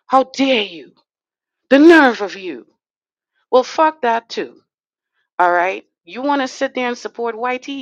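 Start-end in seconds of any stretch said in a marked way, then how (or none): 0.1-2.7 loud mock indignation, voice thrown up on the nerve; mocking his critics' scolding in a loud outraged voice; mock outrage. 3.5-5.9 defiant retort. Real, not acted. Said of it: "defiant retort after mock".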